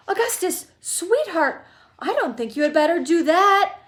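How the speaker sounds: high-pitched anxious mother calling; shouted. A narrator's reading. Mother warning her sweetheart son off, high shout. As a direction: call out high and insistent. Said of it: "shouted Missus Gloop".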